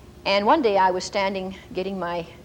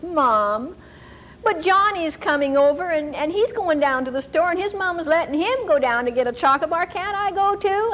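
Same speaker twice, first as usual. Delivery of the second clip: put-on higher, pleading child voice; whiny child; 7 semitones higher than usual. Son begs mom to go with Johnny, higher pleading. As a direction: beg like an eager kid.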